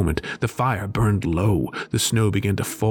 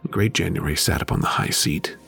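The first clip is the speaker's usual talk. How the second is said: ordinary delivery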